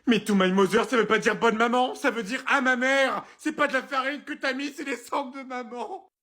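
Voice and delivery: high, distraught protest fading in volume; horrified outrage. Acted. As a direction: wail the awful truth, slowing at the end. Realizes he cooked mother's ashes.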